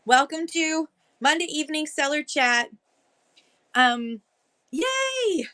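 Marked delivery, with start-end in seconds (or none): 4.7-5.5 excited yay. Real, not acted. Cheering a viewer's arrival.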